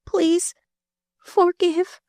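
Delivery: very high, slow, weak repeat; slow weak echo. Acted. Dying girl repeats the prayer slowly. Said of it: "the slow voice repeated".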